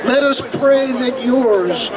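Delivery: ordinary talk, nothing marked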